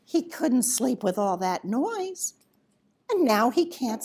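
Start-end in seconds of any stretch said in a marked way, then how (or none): none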